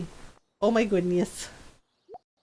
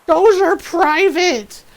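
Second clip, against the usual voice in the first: high, loud, drawn-out indignation; hammed-up angry outburst; mock outrage; 7 semitones higher, 5 dB louder, slower than usual. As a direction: imitate an outraged man protesting, stretched out. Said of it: "he gets mad".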